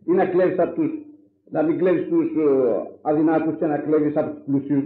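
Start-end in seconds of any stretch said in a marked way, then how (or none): none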